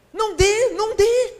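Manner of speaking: preaching shout. Real, not acted. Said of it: "preaching emphasis".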